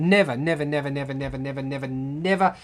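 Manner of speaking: low monotone string of nevers; emphatic repeated denial. Real, not acted. Denying he's mistaken for a New Zealander, droning denial.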